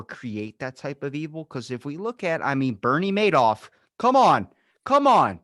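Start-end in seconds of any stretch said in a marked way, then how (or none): none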